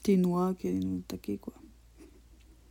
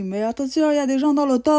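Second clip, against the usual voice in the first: loud, raised warning cry; imitated shout; 6 semitones higher, 10 dB louder than usual. Bystander warning about people in the car, imitated shout. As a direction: call out like an alarmed bystander. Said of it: "Et là, il te crie".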